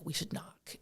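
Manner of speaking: whispered, conspiratorial; hushed suggestion. Retold or imitated. Little brother outside the tour bus, whispered. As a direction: murmur it like a secret dare.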